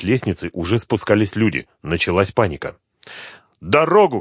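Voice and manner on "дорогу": high, urgent call to a crowd; raised, commanding cry; shouting. A narrator's reading. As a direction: call out to clear the way. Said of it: "крикнула Суслова".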